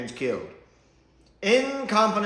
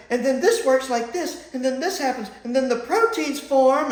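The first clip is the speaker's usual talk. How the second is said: higher and louder, parodying the Doctor's rushed speech; mock excited lecture; 10 semitones higher, 5 dB louder than usual. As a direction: rattle it off like an overeager lecturer. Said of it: "She's like".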